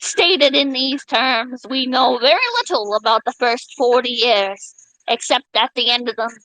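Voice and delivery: slow, low oratorical delivery; formal speech reading. Acted. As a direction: read it like a grand speech. Reads JFK moon speech.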